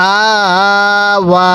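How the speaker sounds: long steady "আ", then long "ওয়া"; stretched vowels. Shown as a demonstration. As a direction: draw out both "আ" and "ওয়া". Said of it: "আপনিও টান দিবেন".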